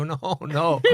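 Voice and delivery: laughing disbelief. Retold or imitated. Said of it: "I go".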